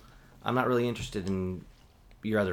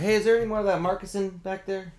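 higher, louder put-on call; 10 semitones higher, 5 dB louder than usual. Bartender asking a coworker about the wine, calling out. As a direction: call casually to the back room.

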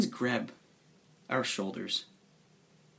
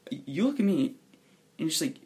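ordinary delivery

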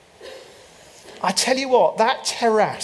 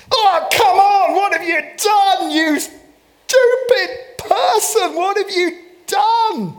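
much higher and louder, hammed-up panic at his wife; mock exasperated outburst; 12 semitones higher, 5 dB louder, slower than usual. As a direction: ham up a pastor losing it, shrieking at his wife. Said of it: "The lead pastor took this well".

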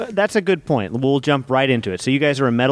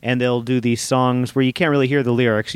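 ordinary talk

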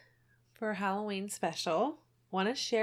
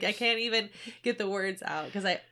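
ordinary delivery

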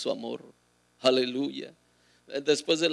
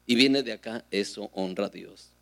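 ordinary talk

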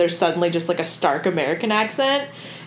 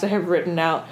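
ordinary talk, nothing marked

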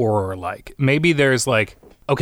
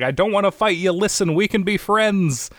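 much higher voice, begging for peace; pleading Hiccup; 9 semitones higher than usual. Acted. Hiccup begging the attacking dragon, high pleading voice. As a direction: talk the dragon down, high and earnest.

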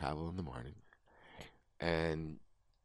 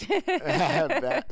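laughing; 11 dB louder than usual. Real, not acted. Laughing at friend's teasing.

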